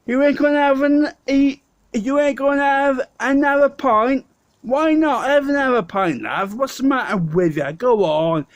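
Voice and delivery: brassy East London Cockney, pushing another pint; Cockney impression. Teresa in Tower Hamlets urging him to drink, Cockney accent. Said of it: "she used to speak like this".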